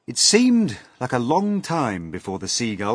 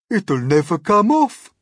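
louder, cheery certainty; brightly; 11 semitones higher, 9 dB louder than usual. A narrator's reading. Earthworm to the centipede, brightly. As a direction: announce the bad news cheerfully. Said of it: "the earthworm said brightly".

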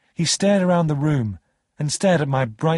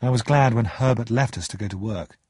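ordinary delivery

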